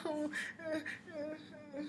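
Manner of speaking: high-pitched mock whine; whining. Wife imitating herself whining to her husband, whiny. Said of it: "like, if I'm whining".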